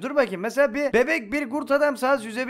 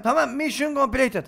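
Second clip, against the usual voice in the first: ordinary talk, nothing marked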